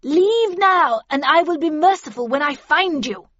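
high, loud warning; forceful, defiant shout; shouted threat. A narrator's reading. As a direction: yell a menacing offer to those outside. Havisham shouts threat to attackers.

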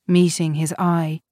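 ordinary delivery, nothing marked

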